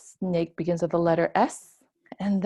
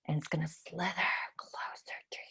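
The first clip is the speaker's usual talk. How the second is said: much softer, fast creeping whisper; spooky whisper; 4 semitones lower, 13 dB quieter, faster than usual. Acted. Teacher teasing kids that the snake creeps closer, whispering. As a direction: whisper like it's sneaking up.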